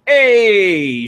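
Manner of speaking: imitated long, high, loud "hey"; delighted reaction. Streamer mimicking people who saw her show off, delighted holler. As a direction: holler it out like an admiring crowd. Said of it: "people were like".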